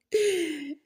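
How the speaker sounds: short high-pitched laugh; quick amused giggle; high chuckle. Acted. Laugh amid the acted dialogue.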